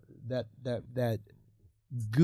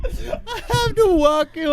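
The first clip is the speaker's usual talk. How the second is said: laughing mock Indian accent, higher and slow; 11 semitones higher, slower than usual. Shown as a demonstration. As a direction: Indian accent, "vacuum" as "wacuum", drawn out. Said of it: "Indian accent".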